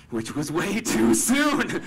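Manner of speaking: loud, high, drawn-out punchline; comic exclamation. Real, not acted. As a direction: blurt it out slowly and loudly, about to laugh. Comic aside after girlfriend agrees.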